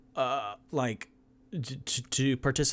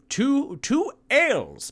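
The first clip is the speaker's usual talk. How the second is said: squeaky high order; 9 dB louder, slower than usual. Acted.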